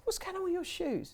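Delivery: high, mocking mate's voice; mate's mocking question. Mate teasing the guy about his shoes, high and mocking. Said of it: "he goes".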